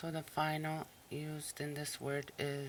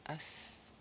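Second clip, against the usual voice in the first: whispered and soft, no voice; unvoiced practice repeat; 6 dB quieter than usual. Shown as a demonstration. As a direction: breathe Askik out in a hush. Whispered repeat after explanation.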